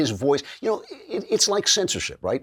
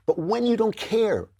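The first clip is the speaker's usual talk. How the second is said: normal talking voice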